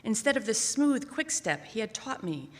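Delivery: usual speaking voice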